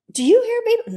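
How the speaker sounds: high, loud, insistent question; urgent asking. Retold or imitated. Woman at a reunion calling out to relatives, high and urgent. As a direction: make them listen to you.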